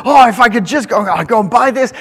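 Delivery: high, loud gushing plea; excited daughter. Retold or imitated. Daughter longing to go shopping, high excited gush.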